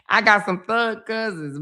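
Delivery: laughing through the line. Real, not acted. Repeating to the audience about her thug cousins, laughing. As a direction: say it again while cracking up.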